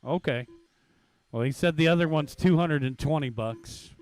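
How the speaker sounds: normal talking voice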